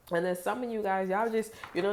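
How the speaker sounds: ordinary talk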